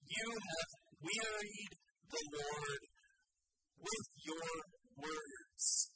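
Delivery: slow, deliberate reading of the verse; slow scripture quote. Shown as a demonstration. Preacher reading the verse to his congregation, slow and weighty. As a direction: lay out each word of the verse slowly. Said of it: "it was explicitly stated".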